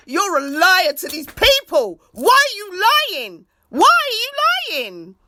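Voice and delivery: high, loud accusing outburst; heated rant. Real, not acted. Vlogger rants at the prime minister, angry and loud. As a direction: call him a liar to his face. Ranting at Boris Johnson.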